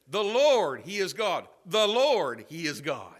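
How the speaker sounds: THE LORD loud and high, then dropping on "he is God"; emphatic proclamation. Retold or imitated. Preacher voicing the people's cry at the fire, emphatic. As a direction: hit "Lord" hard, let the rest fall. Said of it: "There was emphasis there".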